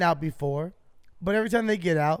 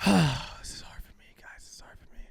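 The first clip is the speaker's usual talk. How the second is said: breathy mock-sad whisper with a sigh; sigh, then whisper it like a sad confession; 4 dB quieter than usual.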